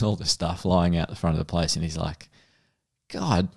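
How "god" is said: burly Aussie bloke. Retold or imitated. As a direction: ham up a disgusted Aussie tradie. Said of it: "a big, burly Aussie bloke".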